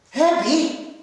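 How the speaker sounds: calling out. Acted. Susan calls the man over.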